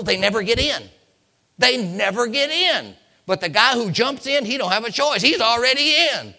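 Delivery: high, loud, rising pulpit cry; full-force preaching. Acted. Preacher urging hesitant people to jump in, full-force preaching.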